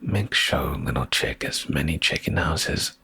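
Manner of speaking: plain reading voice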